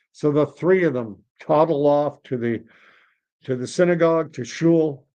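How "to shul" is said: ordinary delivery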